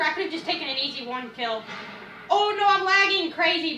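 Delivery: normal talking voice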